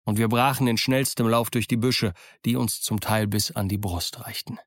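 ordinary delivery

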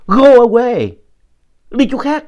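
high, loud shout to scare off a ghost; quát nạt đuổi ma. Retold or imitated. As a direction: shout it as if chasing a ghost away. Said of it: "Rồi tôi nạt bà nữa". (quát to, giọng vút cao)

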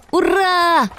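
high cheer; shout. Acted. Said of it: "крикнул Фиты". (радостный крик)

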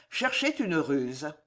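usual speaking voice

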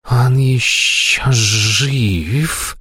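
sneering demoness voice; nightmare spirit. A narrator's reading. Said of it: "прошипела демоница". (шипящий презрительный голос демоницы)

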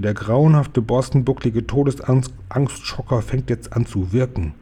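ordinary delivery, nothing marked

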